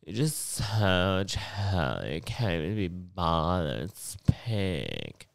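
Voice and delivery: mock slow, croaky vocal fry; lazy glottal fry. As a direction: drawl it out as if too lazy to open the throat. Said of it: "That's how some people sound all the time".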